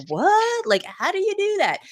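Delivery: high-pitched, stunned "What?"; amazed disbelief. Retold or imitated. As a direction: squeak out your amazement at a magic trick. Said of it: "And I'd be like".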